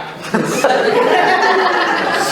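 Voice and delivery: high breathy burst of laughter; laughing at the memory; real laugh. Real, not acted. Laughing at own story.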